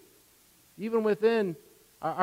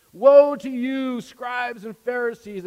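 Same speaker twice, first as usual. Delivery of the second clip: loud, higher and slow, a stern denouncing cry; shouting rebuke; 5 semitones higher, 8 dB louder, slower than usual. Retold or imitated. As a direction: hurl Woe at the Pharisees like a judge. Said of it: "we think of him as just screaming at them".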